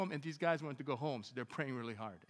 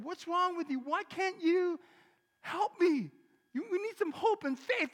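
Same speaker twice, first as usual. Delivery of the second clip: loud, much higher pleading shout; high exasperated shout; exasperated yelling; 11 dB louder than usual. Retold or imitated. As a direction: shake him out of it. Yelling at despairing associate.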